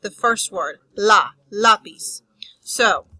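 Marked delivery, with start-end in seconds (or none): 1.0-2.2 the syllable lá alone, then lápiz stressed on it. Shown as a demonstration. Teacher showing where stress falls, lá by itself, then LÁ-piz. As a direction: punch the first syllable of lápiz, lá. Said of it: "The stress in that word falls on".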